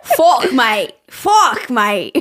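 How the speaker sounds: hammed-up Australian accent. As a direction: practise a broad Australian accent, laughing. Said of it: "I'm trying to get into the character".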